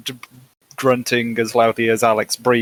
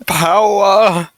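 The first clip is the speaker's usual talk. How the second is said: high, loud burst of mock triumph; mock power cry; 8 semitones higher, 7 dB louder than usual. Joking about being a bad influence, high mock power cry. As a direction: boom out the word with relish. Mock reaction to bullying remark.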